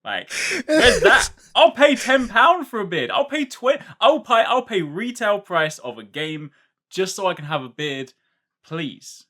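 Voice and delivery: high, loud, slow pleading; laughing plea. Real, not acted. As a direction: beg with mock desperation. Begging for beards, laughing.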